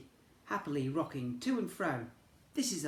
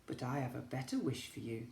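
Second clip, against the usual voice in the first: lower, soft reassuring voice; gentle unicorn; 3 semitones lower, 7 dB quieter than usual. Acted. Unicorn comforting the lonely horse, soft and low. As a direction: comfort him softly. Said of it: "came the voice of the unicorn".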